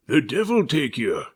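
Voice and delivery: ship's captain roaring back in defiance; defiant roar. A narrator's reading. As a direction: hurl it at the pirates. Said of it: "roared back".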